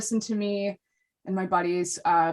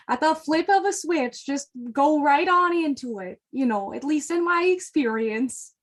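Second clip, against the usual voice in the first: playful, raised-pitch Midwestern twang; Midwestern accent; 5 semitones higher than usual. Shown as a demonstration. Showing podcast guests the Midwestern switch, Midwestern accent. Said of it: "we have the capacity, like, within us".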